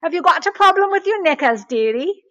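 put-on British accent. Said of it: "in this lovely British accent".

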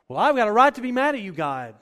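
high, loud, fast mock complaint; mock indignant protest. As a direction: whine fast like an offended believer. Mock believer complaining to God.

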